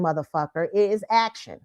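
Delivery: ordinary talk, nothing marked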